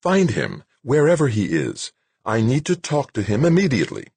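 high-pitched, insistent order; urgent, irritated command; impatient snap. A narrator's reading. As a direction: cut in with an angry demand. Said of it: "she snapped".

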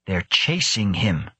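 ordinary delivery

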